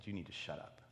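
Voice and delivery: low, quiet, deadly serious warning. Retold or imitated. Homeless man warning the young woman, dead serious. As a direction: say it low and soft, no joking. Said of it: "he looked at her dead serious".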